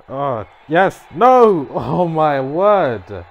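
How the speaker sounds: loud high excited yells turning to a laughing groan; excited shouting. Real, not acted. Gamer sees his pin get kicked out, loud excited yelling. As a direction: yell the hope, then laugh in disbelief. Near-win turns to loss.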